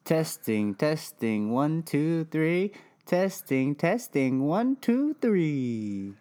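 rising sing-song mic test; playful mic check. Pretend. Mic check, goofy rising count.

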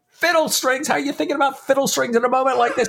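very high, rapid mock disbelief; high-pitched, rushing incredulous question; incredulous Stephen. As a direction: imitate an exasperated friend who can't believe it. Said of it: "I wanna say, Stephen going".

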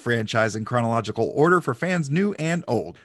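upbeat, projected announcer read; podcast intro. Acted. Host opens Star Trek podcast for fans new and old, announcer style. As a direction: welcome the fans like a show announcer.

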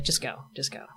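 imitated whisper of a border agent; hushed urging. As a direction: whisper it low, quietly waving someone through. Retelling kind agent waving mother through.

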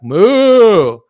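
loud, high, bellowed moo; mock cow moo. Listeners mooing back like cattle, loud bellowed moo. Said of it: "and you're like".

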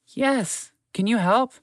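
high squeaky plea; pleading mouse. Acted. Mouse begging the horse to help, high and urgent.

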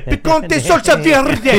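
loud, high, drawn-out mangled Spanish; mock bad Spanish accent. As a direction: imitate a dad botching Spanish, slow and loud. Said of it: "tries to read a Spanish language".

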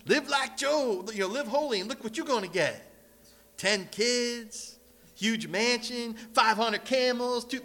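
slow, high prosperity-preacher pitch; mocking a televangelist's sales pitch. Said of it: "those guys on T V".

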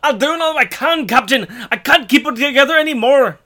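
high, loud, harried Scottish engineer; mock Scottish ship's engineer, pitched high; Scotty impression. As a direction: plead in a strained Scottish accent. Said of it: "MacGyver or Scotty".

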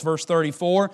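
normal talking voice

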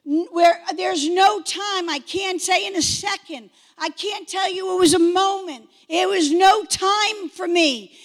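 ordinary talk

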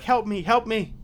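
high, loud panicked cry; frightened woman pleading at the glass; desperate plea. Acted. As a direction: beg frantically. Trapped woman begs for help.

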